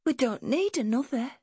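put-on high-pitched child voice; small whispering girl. A narrator's reading. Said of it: "she whispered".

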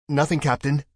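brisk officer answering the captain; crew officer voice. Acted. Crew reports in the dark.

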